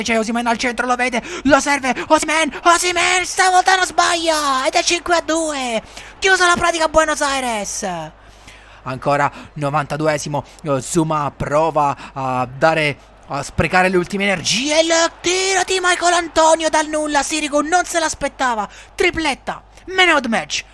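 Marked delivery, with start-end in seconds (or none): 0.0-7.9 breathless italian goal commentary. Acted. Goal call. 14.8-20.6 high excited hat trick call; breathless italian goal commentary. Acted.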